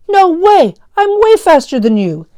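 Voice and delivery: put-on high loud kid retort; shouting back. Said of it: "Daniel shouted back".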